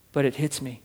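ordinary talk, nothing marked

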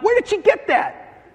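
high-pitched mock bewilderment; exasperated dad. Dad to his wife about rebellious daughter, exasperated. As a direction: squawk it in comic disbelief.

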